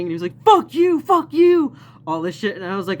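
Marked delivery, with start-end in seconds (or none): 0.4-1.7 high, loud repeated yell; retold yelling. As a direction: bark it twice in his face. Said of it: "started fucking yelling".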